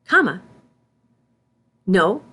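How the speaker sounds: ordinary delivery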